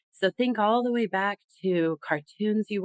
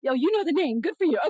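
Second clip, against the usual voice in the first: high-pitched, impressed congratulation; pleasant surprise; 9 semitones higher, faster than usual. Real, not acted. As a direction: react with real, delighted surprise. Pleased listener knows the name.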